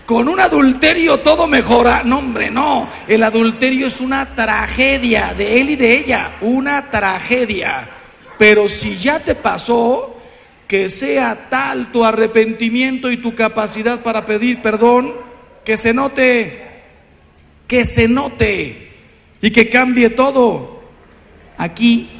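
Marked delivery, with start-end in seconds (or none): 0.0-5.8 raised, shouting no; loud rebuttal. Real, not acted. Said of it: "refutes the idea". 15.6-18.9 each word slowed right down; slow emphasis. Real, not acted. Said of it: "repeated slowly".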